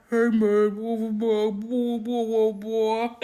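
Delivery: garbled talk as if underwater; muffled underwater voice. Retold or imitated. Host mimics the movie's bad audio, muffled underwater voice. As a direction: imitate muffled, burbling dialogue heard through water. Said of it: "It sounded like they were underwater".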